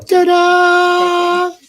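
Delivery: triumphant ta-da, high and loud; excited ta-da. Real, not acted. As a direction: show it off with a big ta-da. Camera finally working.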